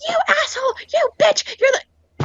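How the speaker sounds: very high mock screech; ranting customer. Customer insulting the manager on the phone, mock fury. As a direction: imitate an irate caller hurling insults. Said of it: "and she's like".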